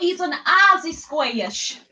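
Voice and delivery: slow, high, bad Aussie accent; a hammed-up Australian drawl from a squeaky critter; lightly put-on Australian accent; rubbish Australian accent. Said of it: "that was a rubbish accent".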